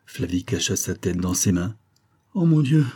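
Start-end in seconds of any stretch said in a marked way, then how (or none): 2.3-2.9 a high, louder anguished "Oh mon Dieu"; distressed cry. A narrator's reading. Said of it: "murmura-t-elle".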